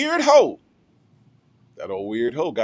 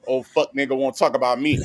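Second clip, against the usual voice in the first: usual speaking voice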